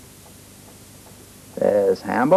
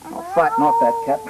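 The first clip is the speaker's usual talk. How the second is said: much higher determined puppet; plucky puppet plotting; lightly voiced puppet. Puppet vows to scare cat.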